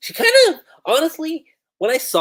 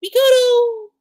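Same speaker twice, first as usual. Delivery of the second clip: freaked-out cry; 10 dB louder than usual. Acted. Classmates react to Yakoto's vow, freaked-out yell. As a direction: cry the name out high and loud. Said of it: "freaks out".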